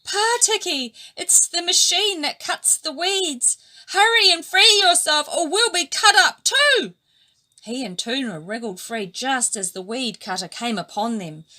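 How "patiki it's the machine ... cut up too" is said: high, loud alarmed warning; urgent warning. A narrator's reading. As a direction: urge him to hurry. Weed cutter coming, warning.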